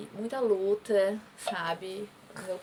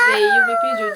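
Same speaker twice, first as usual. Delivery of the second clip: sudden outburst; 14 dB louder than usual. Real, not acted. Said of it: "voice spikes, emotional".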